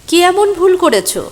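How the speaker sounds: high, loud pressing question; urgent question. Acted. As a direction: push him to answer, high and loud. Urgently asks stranger his mistake.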